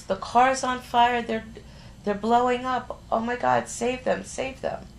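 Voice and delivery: high, frantic pleading in her sleep; retold nightmare panic, pitched high; panicked dream talk. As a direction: cry out about a burning car, voice up high. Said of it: "all you kept saying was".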